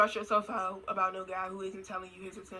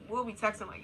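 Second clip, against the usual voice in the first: ordinary talk, nothing marked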